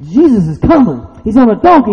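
high, rising pulpit excitement; excited announcement. Real, not acted. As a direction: announce the arrival with mounting excitement. Preacher paints exciting arrival.

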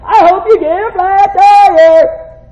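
loud, high, drawn-out mock curse; angry outburst. Driver cursing that guy, mock angry yell. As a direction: yell it slowly in comic road rage.

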